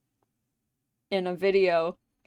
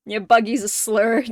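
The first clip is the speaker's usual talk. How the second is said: ordinary talk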